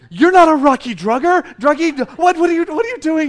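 loud, high incredulous scolding; mock parental outrage. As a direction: play the appalled dad at full volume. Retold dad scolding over rocker shirt.

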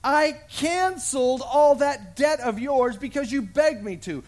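king rebuking the unforgiving servant; angry master. Acted. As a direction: thunder at the wicked servant. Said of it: "And he said".

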